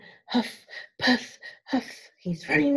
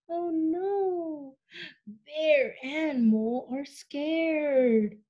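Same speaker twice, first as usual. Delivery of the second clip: ordinary delivery, nothing marked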